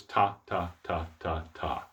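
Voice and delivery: "ta" five times, evenly spaced, falling away; even tonguing syllables. Shown as a demonstration. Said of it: "have all of these sound the same".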